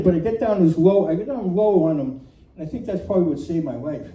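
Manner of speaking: ordinary talk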